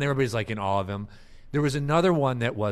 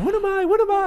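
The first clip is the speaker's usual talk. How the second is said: much higher, louder eager nerd voice; geeky guy; 8 dB louder than usual. Retold or imitated. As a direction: whine it like the geeky friend. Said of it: "a geeky guy going".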